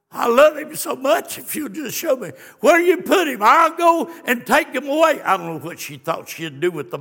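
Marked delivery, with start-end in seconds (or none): 0.1-1.4 louder, higher begging plea; pleading Mary. Acted. 1.4-3.4 higher, louder pleading. Acted. Quoting Mary at the tomb. 3.4-5.2 louder, higher begging plea; pleading Mary. Acted.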